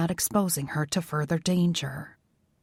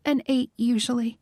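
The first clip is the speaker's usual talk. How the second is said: higher woman's voice; 6 semitones higher than usual. Acted. Isabel's voice.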